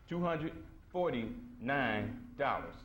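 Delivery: drawn-out, spaced price announcement; slow, deliberate. Real, not acted. Repeating the book's price to audience, slow and deliberate. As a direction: let each word land separately.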